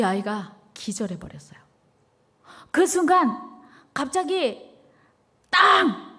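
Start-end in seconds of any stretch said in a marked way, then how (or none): 2.7-6.1 slow, high build then a loud higher bang; slow, rising suspense into a loud mock bang; dramatic gunshot. A narrator's reading. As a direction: land the gunshot with a burst. Gunshot climax.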